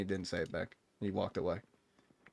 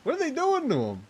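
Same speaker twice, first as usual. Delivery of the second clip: dismayed outcry; 8 semitones higher, 5 dB louder than usual. Retold or imitated. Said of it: "and you're like".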